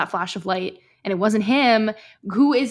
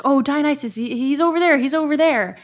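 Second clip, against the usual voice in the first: higher, brighter hurried redirect; hurried pointing; 7 semitones higher, 4 dB louder than usual. Retold or imitated. Will sending the ghost away, mock hurried pointing. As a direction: wave him off fast toward Dionysus. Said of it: "being like".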